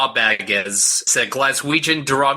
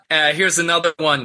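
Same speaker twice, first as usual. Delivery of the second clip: ordinary talk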